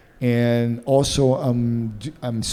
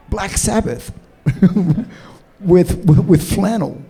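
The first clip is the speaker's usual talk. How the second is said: laughing; 7 semitones higher, 5 dB louder than usual. Real, not acted. Joking realization, laughing.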